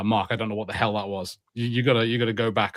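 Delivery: ordinary talk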